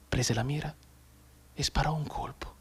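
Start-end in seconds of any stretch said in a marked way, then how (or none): 1.6-2.5 breathy whisper for the fatal shot; whispered, tense; hushed whisper. A narrator's reading. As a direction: drop to a whisper as the rifle fires. Whispered shot in war tale.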